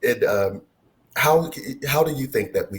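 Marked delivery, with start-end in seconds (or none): none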